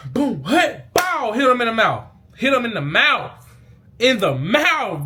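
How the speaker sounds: hyped-up high shout reliving the hit; excited play recap. Retold or imitated.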